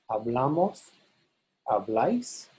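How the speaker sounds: BLA stressed in "Hablamos, habláis". Shown as a demonstration. Teacher models nosotros and vosotros, BLA stressed in "Hablamos, habláis". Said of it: "the bla is stressed".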